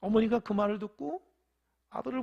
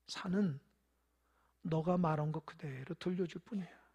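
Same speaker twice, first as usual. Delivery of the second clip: gentle mother; 4 semitones lower, 5 dB quieter than usual. A narrator's reading. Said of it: "그래서 어머니가 얘기합니다".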